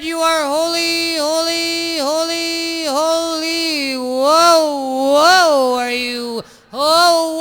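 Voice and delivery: high, sing-song sermon voice; droning priest. Acted. Parody priest sermonizing to his flock, high and nasal-free drone. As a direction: drone on to fill the hour.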